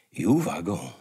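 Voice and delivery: ordinary delivery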